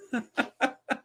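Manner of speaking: high chuckle in quick bursts; amused laughter. Real, not acted. [name] after his Ollie Watkins impression, amused laughter. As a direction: laugh at your own gag.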